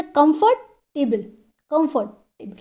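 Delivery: Comfortable split, gap after comfort; comfortable in two separated parts; broken in two. Shown as a demonstration. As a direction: break comfortable into comfort, pause, table. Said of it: "बोलने में हम तोड़ रहे हैं".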